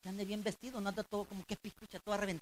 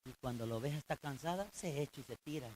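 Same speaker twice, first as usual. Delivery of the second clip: ordinary talk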